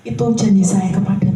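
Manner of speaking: usual speaking voice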